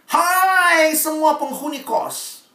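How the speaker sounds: slow high call to the whole boarding house; shout. Retold or imitated. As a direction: call out loudly to everyone. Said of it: "kamu teriak nih". (teriakan keras dan lambat)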